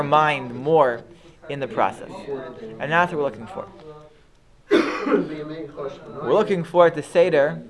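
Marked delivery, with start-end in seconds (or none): none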